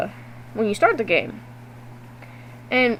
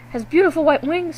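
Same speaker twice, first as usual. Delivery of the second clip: ordinary talk, nothing marked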